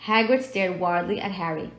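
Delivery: usual speaking voice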